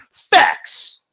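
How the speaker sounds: breathy, cocky closing facts; smug mock facts. Shown as a demonstration. Demo of a confident fake argument, cocky mock facts. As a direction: slam it home with fake certainty. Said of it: "spilled that fucking diarrhea with confidence".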